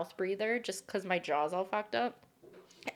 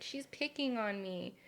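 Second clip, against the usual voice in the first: small complaint; 8 dB quieter than usual. Retold or imitated. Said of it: "'cause I was like".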